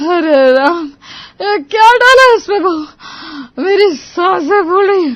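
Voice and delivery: loud, high, gasping distress; coughing fit. Acted. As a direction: choke out the words, short of breath. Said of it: "उसे खांसी का दौरा पड़ गया". (हाँफती, खाँसती घबराई आवाज़)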